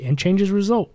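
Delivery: usual speaking voice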